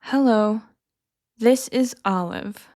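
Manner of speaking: slow, careful model line; slowly first. Shown as a demonstration. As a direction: spell it out slowly for learners. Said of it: "I'll say each one slowly first".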